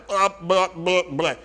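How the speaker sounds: mock fumbling for words; tongue-tied babble. Trainer caught unready as clients see the gym, mock babbling. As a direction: act out blurting nonsense syllables. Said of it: "and you're like".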